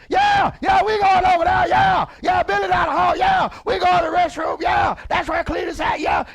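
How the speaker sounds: shouting high and loud across the room; hammed-up loud coworker shouting down the hall; loud factory worker. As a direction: yell it like a plant worker over machines. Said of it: "If you talk to somebody".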